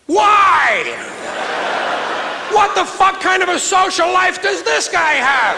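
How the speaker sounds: incredulous shout. Retold or imitated. Comic reacting to a news story, shouting in disbelief. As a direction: bellow it in disbelief. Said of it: "I'm thinking to myself".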